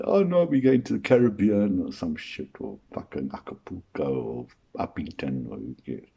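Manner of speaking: the groom brushing off honeymoon plans, mock bored; dismissive shrug. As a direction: wave it away, fading out.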